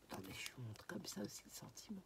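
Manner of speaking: quick whisper; hushed, hurried aside. Real, not acted. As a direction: whisper it quickly to yourself while fiddling. Quick aside while handling cards.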